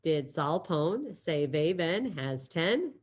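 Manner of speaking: teacher modelling the phrase for students to repeat; slow mnemonic. Shown as a demonstration. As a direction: spell out the mnemonic slowly. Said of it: "in your head, you're saying".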